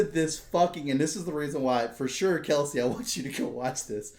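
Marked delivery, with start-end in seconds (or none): none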